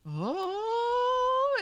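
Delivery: surprised oh. Real, not acted. Surprised exclamation.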